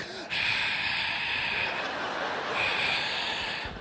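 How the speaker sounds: long exaggerated put-upon sigh; mock teen sigh. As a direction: sigh like a dramatic teenager. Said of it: "He's like".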